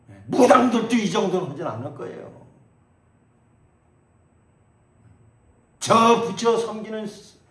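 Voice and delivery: loud, high rebuking sermon; impassioned preacher's reproach; preaching at full force. Acted. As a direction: thunder the challenge at the pews.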